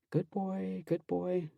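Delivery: soft hushed whisper to the dog; hushed and gentle. Acted. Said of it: "I whisper to him".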